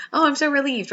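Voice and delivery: high, loud gush of relief; relieved. Retold or imitated. Telling the woman the scratch is gone, high relieved gush. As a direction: let the relief burst out. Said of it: "I was like".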